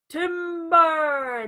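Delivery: loud, long lumberjack call; yell. A narrator's reading. Paul yelling as the tree falls, loud and drawn out. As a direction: bellow it across the woods. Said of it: "Then he'd yell".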